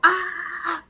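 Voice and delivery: high, very loud "ah"; sudden negative yell to startle a dog; loud startling yell. Shown as a demonstration. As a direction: shout a sharp, high warning noise. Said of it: "just make a loud noise".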